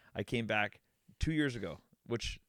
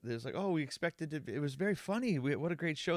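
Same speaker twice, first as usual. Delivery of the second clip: higher-pitched, placating client; polite backpedaling; 6 semitones higher than usual. Retold or imitated. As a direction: cushion a complaint with hurried-up praise. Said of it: "backpedaling and like".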